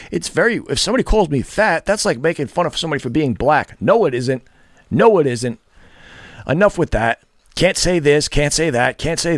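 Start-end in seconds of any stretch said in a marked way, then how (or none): none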